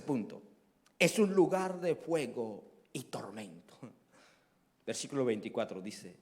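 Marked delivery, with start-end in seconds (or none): 2.9-3.8 whisper. Acted. Preacher whispers point about hell.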